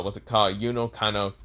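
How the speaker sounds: plain reading voice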